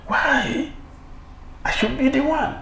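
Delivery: petulant jealous claim; jealous rival. Acted.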